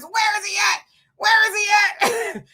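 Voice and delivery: high, heated demand; angry big brother wanting the man; protective brother. Retold or imitated. Brother hearing what happened, heated high demand. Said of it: "He says".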